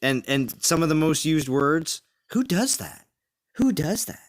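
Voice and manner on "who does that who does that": high put-on voice, teasing; high mocking catchphrase voice. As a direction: quote the catchphrase in a squeaky voice. Mimics a catchphrase.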